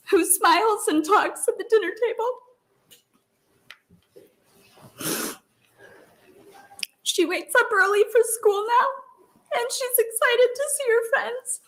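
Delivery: high, tight voice on the edge of crying; a mother crying while she testifies; tearful. Real, not acted. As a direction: push through the tears. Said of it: "I'm so sorry, this is embarrassing".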